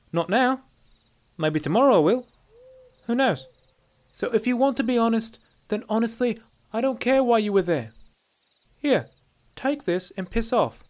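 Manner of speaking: high, loud she-elf voice; dismissive dark elf woman. A narrator's reading. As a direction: brush the stranger off bluntly.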